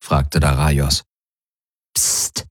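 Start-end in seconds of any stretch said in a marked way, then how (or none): 1.9-2.5 leises, stimmloses Zischen zum Schweigen; Psst. A narrator's reading. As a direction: mahne geflüstert zur Ruhe. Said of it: "machte Kirai". (geflüstertes Psst)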